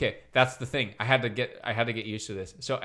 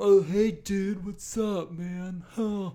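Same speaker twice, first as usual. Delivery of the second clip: higher, stretched-out Californian dude voice; mock California accent; 5 semitones higher, 3 dB louder, slower than usual. Mimicking outsiders' idea of Americans, mock California dude accent. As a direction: overdo a California surfer accent. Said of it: "we don't sound like California".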